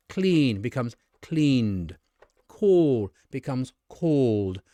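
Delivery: slow, drawn-out "cleaned" and "called", each ending in a voiced d. Shown as a demonstration. Teacher showing clean becomes cleaned, slowly, with voiced d. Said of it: "we pronounce the E D like d".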